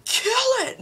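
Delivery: high-pitched acted squeal; sudden high, panicky order; mock panic. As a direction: squeal it in pretend terror. Acting out panic at roach.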